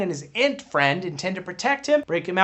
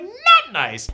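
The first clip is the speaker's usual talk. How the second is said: upset; 12 semitones higher, 6 dB louder than usual. Retold or imitated. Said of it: "he's like".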